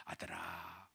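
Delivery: very soft, low call; gentle fatherly coaxing. Acted. Father to his furious older son, gently. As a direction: hush down to soothe an angry son.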